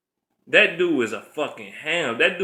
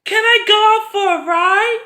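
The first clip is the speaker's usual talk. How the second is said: put-on much higher, whiny little-kid plea; mocking a sitcom kid asking to go out, in a much higher whiny kid voice; 6 dB louder than usual. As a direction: beg like a bratty child on a sitcom.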